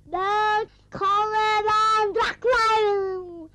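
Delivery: long, slow wailing shout; drawn-out yell. Acted. Child yelling in a quarrel with a neighbour, drawn-out howl. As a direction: stretch every word into a yell.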